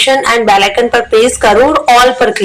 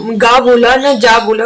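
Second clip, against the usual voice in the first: usual speaking voice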